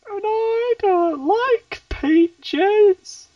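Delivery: very high, slow, loud character whine; hammed-up high-pitched complaint; catchphrase voice. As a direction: draw it out in a squeaky put-on voice. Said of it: "people keep demanding I keep saying these".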